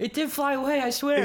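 high and loud, laughing protest; excited insisting. Real, not acted. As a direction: swear it's true, half laughing. Insisting the turkey survived.

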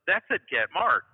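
a half-acted exclaim of the message; retold excited call. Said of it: "And the guy son goes".

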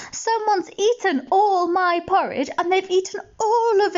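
squeaky tiny bear, wailing over its porridge; small upset cub; baby bear. A narrator's reading. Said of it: "said the baby bear".

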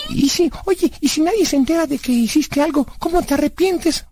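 put-on high-pitched kid character puzzling it out; high cartoonish inquisitive voice; childlike Blas, questioning. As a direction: ask in a high, naive character voice.

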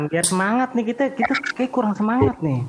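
excited. Acted. Urging more energy.

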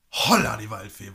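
lauter, staunender Ausruf; überrascht ausrufen; verblüfft. Real, not acted. Staunt über viele Schnitte. (laut und verblüfft)

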